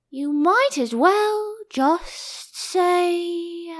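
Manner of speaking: high, slow, drowsy voice; sleepy Dormouse. A narrator's reading. Dormouse talking in its sleep, slow and high. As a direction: drift off mid-sentence. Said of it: "talking in its sleep".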